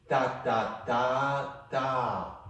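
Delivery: ta ta ta ta clapped out in rhythm; rhythmic syllables, short short long longer; rhythm demo. Shown as a demonstration. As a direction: sound out the rhythm for the student. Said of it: "So do it again with me, after me".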